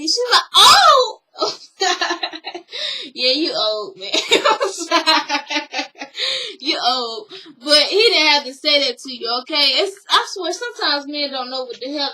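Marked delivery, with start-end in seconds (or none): 0.6-1.2 a loud, high shocked oh; shocked shriek. Retold or imitated. Mother-in-law's shocked cry. 1.8-3.1 high burst of laughter; real laugh. Real, not acted. Laughing at the insult. 3.1-7.3 words broken by laughter; laughing. Real, not acted. Laughing while saying it.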